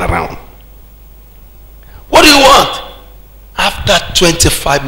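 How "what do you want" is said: high, exasperated question; impatient demand. Retold or imitated. Preacher retelling his fed-up question to a rambling visitor, impatient. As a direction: re-enact losing patience, pitch jumping up.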